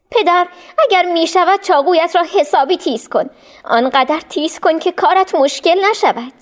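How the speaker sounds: a son asking his father gently; lightly voiced boy; young son's voice. A narrator's reading. Son Ismail speaks to father.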